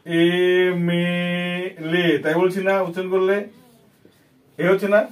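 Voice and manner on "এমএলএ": এমএলএ drawn out very slowly, sound by sound; slowly stretched out. Shown as a demonstration. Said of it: "আগে উচ্চারণ করেছি, দেখ". (ধীরে টেনে টেনে এমএলএ)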